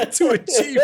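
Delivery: laughing hard mid-story; real laughter breaking through the words. Real, not acted. Laughing while telling friend about the chief.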